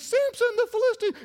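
very high falsetto alarm; shrieking Delilah. Acted. Preacher as Delilah waking Samson, high alarm. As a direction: squeal it out in a woman's falsetto.

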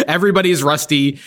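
normal talking voice

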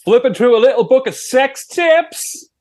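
cocky-sounding Sheffield singer impression. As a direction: greet the host in a broad Sheffield accent. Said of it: "[name] from Arctic Monkeys".

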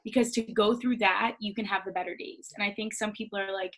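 usual speaking voice

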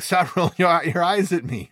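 loud, high-pitched exasperated retort. Retold or imitated. Teacher to doubting intermediate students, exasperated.

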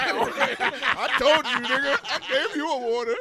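laughing scold. Real, not acted. Laughing scold at drunk friend.

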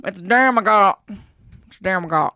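playful mock southern accent; southern twang. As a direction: lay the southern twang on thick. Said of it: "in my southern accent twang".

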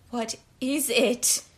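slow, drawn-out insistent question; insistent repeat. A narrator's reading. Prisoner demanding to know about the packet, insistently. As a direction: press the question slowly, word by word. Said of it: "she repeated".

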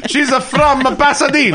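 high laughing mock Italian accent; hammy Italian accent through laughter. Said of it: "we put on a little accent".

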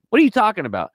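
high, loud disbelief as the friend; retold shocked outburst. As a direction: act the friend stunned by the news. Advisor shocked by quitting.

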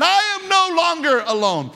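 high, bright exclamation of relief; joyful exclaim. Acted. Preacher voicing Adam's relief, joyful exclaim. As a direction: cry it out as a man no longer alone. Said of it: "Here's what he said".

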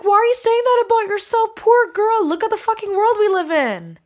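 loud, high, exasperated outburst; impassioned retort. Retold or imitated. Woman answering critics of her self-talk, loud and exasperated. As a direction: throw it back hard, indignant.

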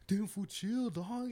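high, loud alarmed plea; startled plea. Retold or imitated. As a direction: jump in high to stop the fight. Retold friend calming a fight.

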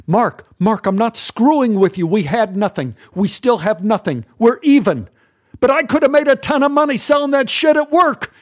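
high, loud desperate pleading; frightened pleading at gunpoint; panicked pleading. Acted. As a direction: talk fast, scared for your life. Pleading with gun at face.

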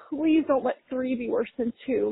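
normal talking voice

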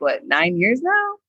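ordinary delivery

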